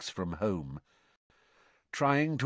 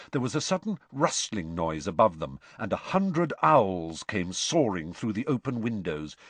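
usual speaking voice

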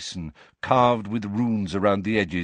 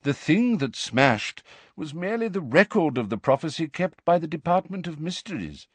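ordinary delivery, nothing marked

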